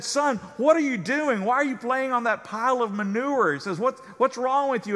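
can't believe what he's seeing; baffled professor. Acted. Professor asking boy on manure pile, high and astonished.

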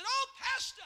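high, wondering cry to her pastor; imitated awe. Dying woman sees heaven open, imitated awed cry.

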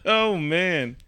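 normal talking voice

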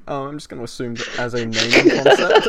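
words breaking into laughter; laughing through. Real, not acted. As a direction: fight back giggles. Laughing after burp.

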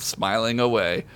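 usual speaking voice

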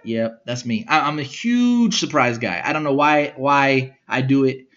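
ordinary talk

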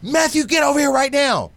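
high, loud command; angry dad shout. Retold or imitated. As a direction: bark the order across the room. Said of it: "He's like".

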